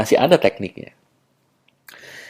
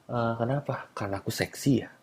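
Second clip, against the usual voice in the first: teasing accusation; 12 dB quieter than usual. Acted. Man catching a nervous woman out, soft teasing accusation.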